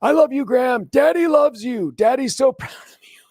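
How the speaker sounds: high, loud gushing dad voice; hammed-up doting father yelling across the school; proud dad yelling. Dad yelling love at his son on first day, high and loud. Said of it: "yelling at the top of his lungs".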